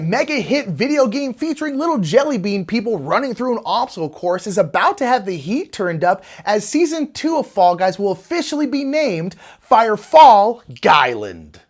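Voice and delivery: ordinary talk, nothing marked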